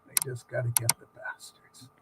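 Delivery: storyteller imitating the crew-cut woman, low and deadpan; low deadpan quote. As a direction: drop the voice low and flat for her line. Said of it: "And she goes".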